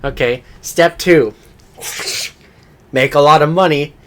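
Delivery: loud, building presenter reveal. Retold or imitated. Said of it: "it's just like".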